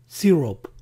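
"syrup" as SEE-rop; quiz option pronunciation. Shown as a demonstration. Teacher offers a choice for pancake syrup, "syrup" as SEE-rop. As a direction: say "syrup" ending in a round "rop". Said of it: "¿cómo se pronuncia en inglés?".

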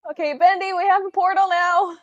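loud, high announcement to a companion; calling out news. Acted. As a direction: shout it across to them.

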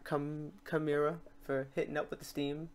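ordinary talk

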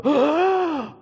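loud, high mock gasp; big exaggerated intake of shocked breath; crowd gasp. Crowd seeing Lazarus come out, shocked gasp. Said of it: "and a crowd go".

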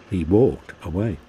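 usual speaking voice

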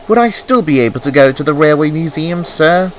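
high, small, hopeful question; Thomas. A narrator's reading. Thomas asks about the Railway Museum, high and hopeful.

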